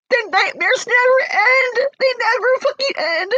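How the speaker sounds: high, anguished cry; real sobbing outburst, pitch high. Real, not acted. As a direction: wail the words out through tears. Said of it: "I'm here right now crying".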